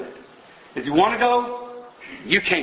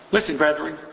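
ordinary talk, nothing marked

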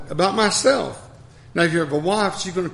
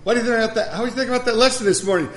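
eager question; 5 semitones higher, faster than usual. Retold or imitated. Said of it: "I said".

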